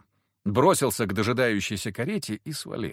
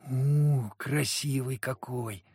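admiring; 3 semitones higher, much slower than usual. A narrator's reading. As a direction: marvel at him, drawn out. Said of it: "раздалось восхищённое".

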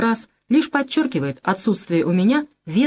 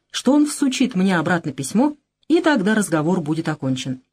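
ordinary delivery

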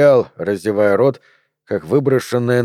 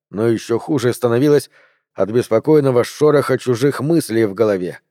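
ordinary delivery, nothing marked

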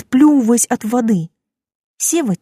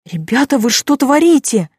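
higher, louder indignant cry; alarmed protest; 6 semitones higher, 4 dB louder than usual. Acted. Heroine protesting being dragged.

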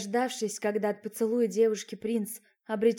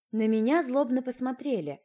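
plain reading voice

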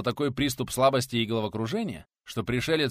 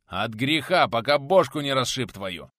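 loud, rough warning; growled threat; 3 semitones higher, 7 dB louder than usual. Acted. Teacher threatening his drinking friend, loud gruff growl. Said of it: "рыкнул Булыцкий".

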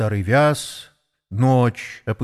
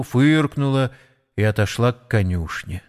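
usual speaking voice

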